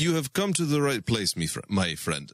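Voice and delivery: put-on Russian-accented host of a help line. Support agent welcomes a caller who needs help, Russian accent. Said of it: "[name]'s Russian accent".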